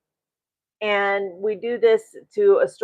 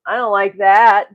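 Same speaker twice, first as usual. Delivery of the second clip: firm disapproval; 8 dB louder than usual. Real, not acted. Emphatic disapproval.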